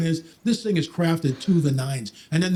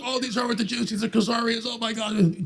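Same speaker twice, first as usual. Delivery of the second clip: higher-pitched mock objection; parroting a dismissed argument in a raised voice; mocking objector; 6 semitones higher, 3 dB quieter than usual. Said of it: "don't give me the stuff".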